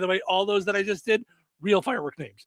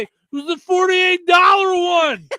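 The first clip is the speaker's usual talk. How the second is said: mock shout; 8 semitones higher, 7 dB louder, slower than usual. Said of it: "as Jim Brewer said".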